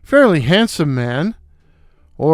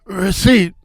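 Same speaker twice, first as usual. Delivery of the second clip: weak shout; 3 semitones higher, slower than usual. Acted. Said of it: "or at least as loud as I could shout".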